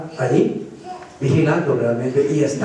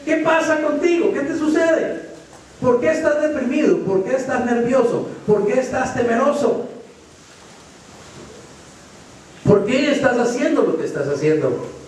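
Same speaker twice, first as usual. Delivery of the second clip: much higher, pleading questions; God questioning Elijah; 8 semitones higher than usual. Acted. Preacher voicing God asking Elijah what is wrong. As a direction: press him gently, higher each time. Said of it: "Como quien dice".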